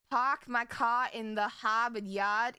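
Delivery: a light imitation of Boston, dropping the r's in "car" and "Harvard"; a half-hearted Boston accent, the old classic line; mock Boston accent. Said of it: "highly butchering your accent".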